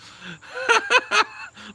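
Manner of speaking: high shrieking mock laugh; a hammed-up shrieking cackle.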